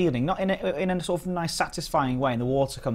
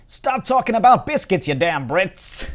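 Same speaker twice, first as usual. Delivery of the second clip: mock American; 6 semitones higher, 7 dB louder, slower than usual. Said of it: "the Americans were going".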